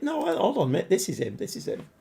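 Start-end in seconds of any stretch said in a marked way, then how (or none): 1.4-1.9 breathless whisper under his breath. Real, not acted. A player finds his lost signing and whispers to himself. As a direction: mutter it to yourself, barely voiced.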